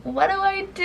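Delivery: high-pitched mock wail; retold bawling. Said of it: "I was bawling".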